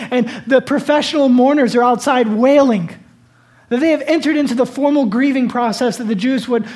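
loud, high, impassioned Bible storytelling; full-force preaching. Real, not acted. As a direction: cry out the tragedy from the pulpit.

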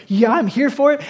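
eager fan. Retold or imitated. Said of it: "some of them are probably like".

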